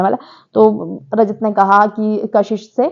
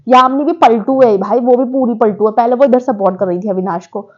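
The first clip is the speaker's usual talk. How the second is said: ordinary delivery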